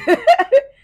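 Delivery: high, loud burst of laughter. Real, not acted. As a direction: snort out a quick laugh. Laugh at own cursing.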